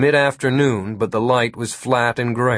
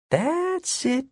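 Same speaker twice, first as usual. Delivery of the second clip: inner thought voice; 5 dB quieter than usual. Acted. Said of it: "Jimmy thought".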